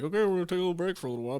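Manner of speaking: quick, high imitation of his partner; mock partner voice. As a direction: rattle it off fast and high. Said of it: "You're like".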